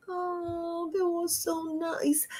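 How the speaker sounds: soft, high, drawn-in sigh of appreciation; fond reliving. Acted. Recalling a kindness for the gratitude journal, soft and fond. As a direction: say it very softly and high, savoring it.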